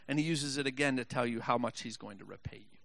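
ordinary talk

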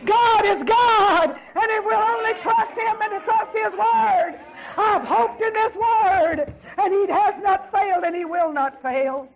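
loud, high shouted preaching; fervent preaching. Real, not acted. Preacher exhorting the congregation to trust His Word, fervent preaching. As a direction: drive each word out at full force.

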